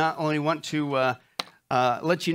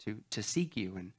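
softer, earnest prayer voice; opening prayer; 3 semitones lower, 4 dB quieter than usual. Real, not acted. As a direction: pray sincerely on behalf of the congregation. Pastor leads opening prayer.

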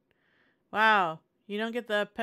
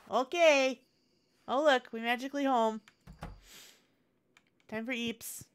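normal talking voice

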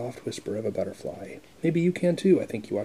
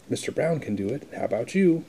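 usual speaking voice

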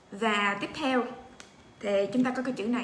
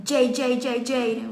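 emphatic letter drill; 6 dB louder, much slower than usual. Shown as a demonstration. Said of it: "mình lấy cái mẹo".

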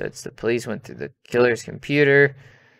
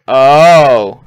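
startled gasp; 14 dB louder than usual. Real, not acted. Gasp at the game.

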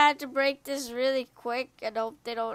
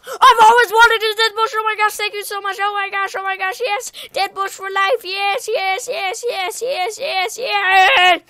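high, loud, gushing squeals; over-the-top excitement; 8 semitones higher, 13 dB louder than usual. Pretend. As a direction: lose it with joy over a worthless prize. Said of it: "this guy won't get excited over a dead bush".

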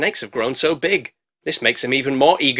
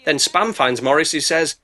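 usual speaking voice

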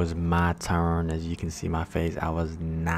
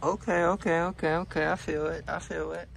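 high, tense gasps in ice water; cold shock, breathless; gasping from the cold; 10 semitones higher, faster than usual. Real, not acted. First dip into ice bath.

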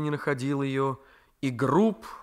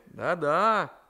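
plain reading voice

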